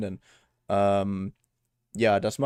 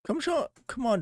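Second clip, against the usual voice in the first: exasperated plea. Real, not acted. Pleading with broken device.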